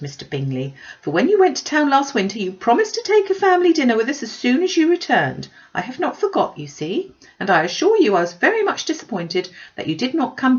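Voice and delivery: high, loud, breathless gossiping mother; gushing Mrs Bennet. Acted. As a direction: pour out the news like an excited busybody. Mrs Bennet voiced by narrator.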